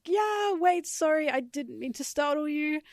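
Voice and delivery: high, mock-alarmed apology; retold fleeing yell. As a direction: call it out high, as if backing away. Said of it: "runs away and yells".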